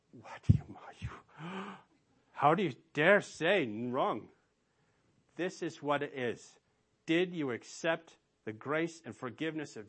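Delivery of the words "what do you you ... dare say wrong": gasp, then loud indignant whine; mock outrage. Preacher acts out an offended listener, with a gasp and loud outrage. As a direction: play the offended listener, gasping.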